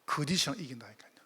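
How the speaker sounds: hushed, unvoiced whisper, a touch quick. Real, not acted. Pastor to congregation, repeating the promise in a whisper. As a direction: drop to a breathy secret for emphasis.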